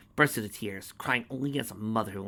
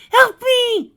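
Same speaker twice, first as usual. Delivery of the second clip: extremely high, loud scream for help; terrified scream; 17 dB louder than usual. Acted. Mare screaming for help as Conquest takes her, terrified scream. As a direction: scream for your life.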